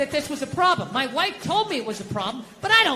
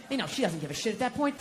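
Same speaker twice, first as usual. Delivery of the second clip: normal talking voice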